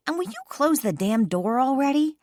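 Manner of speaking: irritated snap, pitched high; snapping. A narrator's reading. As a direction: snap at him to shut the door. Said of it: "I snapped at Cam".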